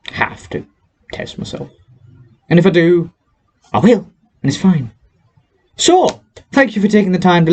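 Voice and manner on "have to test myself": under-the-breath mutter; whispered aside. Real, not acted. Muttering to viewers about self-testing, whispered aside.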